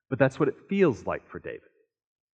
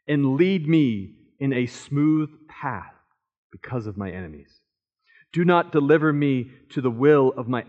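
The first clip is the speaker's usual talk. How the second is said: slow, earnest psalm reading, swelling louder on the pleas; scripture reading; 3 dB louder than usual. Acted. Pastor reading the psalm to the congregation, earnest and prayerful. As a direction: read the psalm as a heartfelt prayer.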